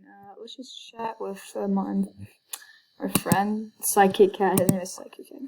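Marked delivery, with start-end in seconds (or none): 4.8-5.5 unvoiced whisper, breath only. Shown as a demonstration. Introducing his friend Psychic Cat in a whisper. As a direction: breathe the name out like a secret.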